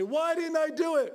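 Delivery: high, loud wail of regret; anguished cry. Retold or imitated. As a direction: cry out like a soul at judgment. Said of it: "You just say".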